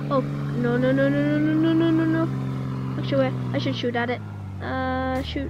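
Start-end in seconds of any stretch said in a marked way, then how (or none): 0.0-3.0 gasp, then rising stream of no's; rapid-fire repeats climbing into panic; panicked. Real, not acted. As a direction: build louder and higher in alarm. Panics as the shark comes.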